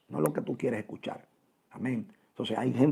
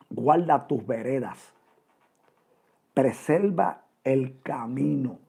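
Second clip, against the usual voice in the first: solemn scripture reading; 2 semitones higher, 6 dB louder, slower than usual. Acted. Preacher reads a Bible verse aloud.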